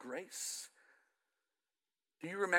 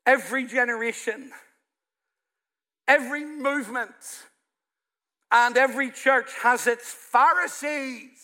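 loud, higher, slow and emphatic sermon; preaching at full force; 6 semitones higher, 8 dB louder, slower than usual. Acted. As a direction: proclaim it like a pulpit climax, drawing out every phrase. Preacher builds to climax.

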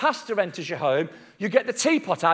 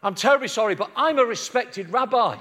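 mock outrage, rising higher to the end; pompous, scandalised objection; offended respectable rabbi; 5 semitones higher than usual. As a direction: build from polite apology to indignant protest. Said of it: "Jesus doesn't turn to her and say".

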